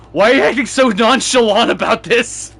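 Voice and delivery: high, loud exasperated retort; retold incredulity. Son to unbothered mom about exploded phone, retold incredulous outburst. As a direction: throw it out as mock disbelief.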